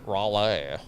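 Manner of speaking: Raleigh said as "RAH-lay"; chosen pronunciation. Shown as a demonstration. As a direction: answer with Raleigh opened to "rah-leh".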